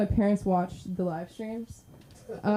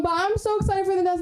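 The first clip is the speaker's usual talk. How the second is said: high-pitched gushing excitement; excited hype; 11 semitones higher, faster than usual. Real, not acted. Host to crowd before the next comic, high excitement. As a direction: squeal it out to hype the next act.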